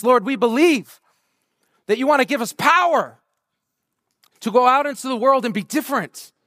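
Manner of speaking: prayer building to full-force preaching; fervent prayer. Acted. Pastor leading the room in prayer, building fervently.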